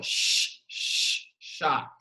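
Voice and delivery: a whispered "sh" drawn out, then "chá"; hissing sound. Shown as a demonstration. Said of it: "Esse som chiado".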